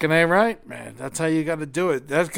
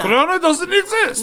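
put-on Russian accent; 12 semitones higher, 6 dB louder than usual. Mock Russian denial.

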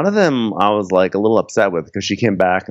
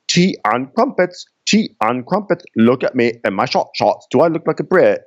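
mock British accent; 4 semitones higher than usual. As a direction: play a bad British accent, stretching "crumpets". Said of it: "can't understand a British accent".

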